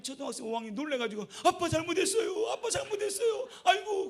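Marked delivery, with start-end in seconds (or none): 1.4-4.1 high, loud, tearful begging; scared son pleading with his father, high and loud; frightened pleading. Retold or imitated. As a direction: beg a father not to punish you. Frightened boy begs father.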